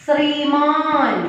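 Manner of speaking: ശ്രീമാൻ drawn out slowly, both long vowels held; model pronunciation. Shown as a demonstration. Teacher models ശ്രീമാൻ for the class, slow careful pronunciation. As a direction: say ശ്രീമാൻ slowly for learners to copy. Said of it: "അതിന്റെ correct pronunciation ആണ് പറയുന്നത്".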